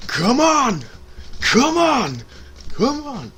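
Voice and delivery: high, loud mock Vince yell; Vince begging crowd. Imitating Vince begging the crowd, loud. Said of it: "Vince starts going".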